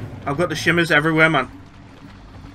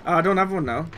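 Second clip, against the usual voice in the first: ordinary talk, nothing marked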